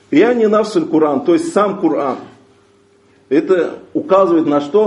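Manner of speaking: normal talking voice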